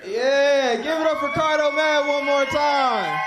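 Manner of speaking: loud, high crowd hype; hype host. Real, not acted. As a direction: whip the crowd up for the comic.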